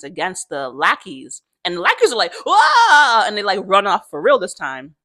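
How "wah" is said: loud, high comic wail; mock frightened scream. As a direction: yell like fleeing henchmen, hammed-up. Lackeys fleeing in fright.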